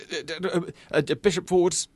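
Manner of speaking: high stammering panic; flustered. Pretend. Speed chess, flustered stammer.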